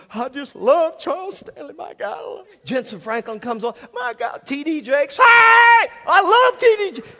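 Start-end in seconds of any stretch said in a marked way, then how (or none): 0.1-2.4 higher, swooning enthusiasm; gushing fan. Acted. TV viewer as Charles Stanley comes on, gushing. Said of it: "and you go". 3.9-7.1 high, very loud hollering praise; ecstatic fan shout. Acted. TV viewer thrilled as the preacher comes on, ecstatic yelling. Acting a fan's ecstatic reaction.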